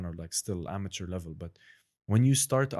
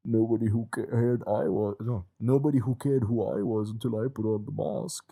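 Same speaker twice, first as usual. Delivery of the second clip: louder masked Bane voice; Bane impression; 4 dB louder than usual. Asked to do Bane, friend booms the villain's line. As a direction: boom it out like Bane behind the mask. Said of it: "So it's like (Bane)".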